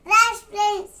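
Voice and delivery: put-on child's loud shouted farewell; loud on request. Shown as a demonstration. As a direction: belt it out to the viewers. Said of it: "గట్టిగా చెప్పాలి".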